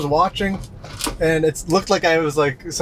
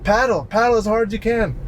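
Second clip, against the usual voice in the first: higher-pitched imitation of urgent commands; retold urgent instructions, pitched up; urgent lifeguard; 7 semitones higher than usual. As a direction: mimic a lifeguard calling out orders.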